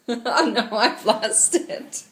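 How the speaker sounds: loud, giggly admission of defeat; laughing at herself. Real, not acted. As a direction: laugh through having forgotten the words. Laughing at her own failed attempt.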